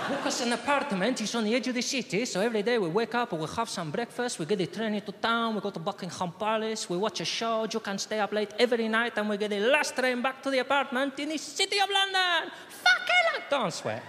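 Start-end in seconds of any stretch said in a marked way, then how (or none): 0.1-12.5 higher, lively Spanish dad; excited holiday pitch in Spanish accent. Acted. Spanish father telling son about London trip, Spanish accent. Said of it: "Spanish accent". 12.7-13.4 shocked yelp. Acted. Father's aside in character.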